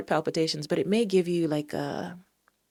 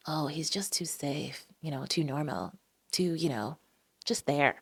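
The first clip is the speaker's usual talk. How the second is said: mock bored whisper; 2 semitones lower, 7 dB quieter than usual.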